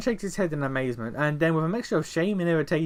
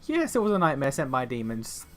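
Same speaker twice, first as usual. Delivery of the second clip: ordinary delivery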